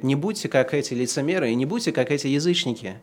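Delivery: normal talking voice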